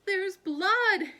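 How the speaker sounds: very high, loud horrified cry; aunt shocked by her toe; gasping in horror. A narrator's reading. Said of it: "gasped Aunt Figgy".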